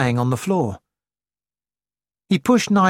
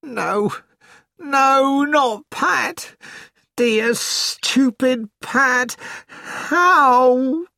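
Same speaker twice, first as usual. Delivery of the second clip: grief-stricken; 10 semitones higher, much slower than usual. A narrator's reading. Grieving over dead girlfriend.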